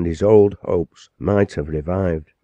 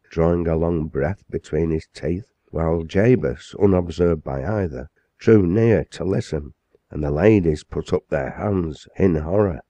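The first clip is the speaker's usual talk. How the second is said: ordinary delivery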